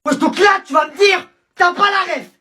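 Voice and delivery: shrieking high, loud repeated question; hammed-up fury at his ignorance; screeching comic indignation; mock outrage. Escalating outrage over reference.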